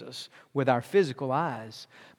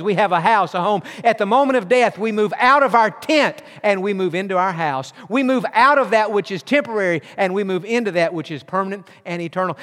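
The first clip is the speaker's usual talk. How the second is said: raised, fervent preaching voice; 5 semitones higher, 5 dB louder than usual. Acted. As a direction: preach it out to the congregation. Preaching at full force.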